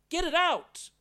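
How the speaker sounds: loud, high cry to get it out; Paul crying loudly to God, high and urgent; desperate demand. Retold or imitated. Paul demanding thorn removed.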